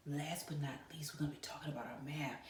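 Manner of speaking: secretive whisper to the class. Acted. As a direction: whisper like sharing a secret with kids. Teacher teasing next topic.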